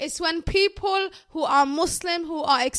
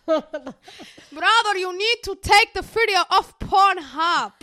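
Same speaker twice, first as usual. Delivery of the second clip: put-on louder, slower Somali mum accent; 4 semitones higher, 5 dB louder, much slower than usual. Friend imitating a Somali mum accent, scolding. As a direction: scold him to take the video down like a Somali mum. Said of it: "I just love her accent".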